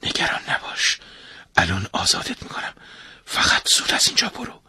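whispered secretly into his ear; soft, voiceless whisper of reassurance. Acted. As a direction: breathe it urgently so the father won't hear. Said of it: "آهسته توی گوش ابراهیم گفت".

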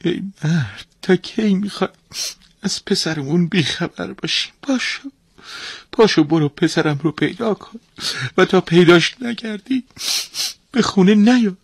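high-pitched acted pleading turning to command; distraught, demanding mother. Mother sending husband after their son, distraught.

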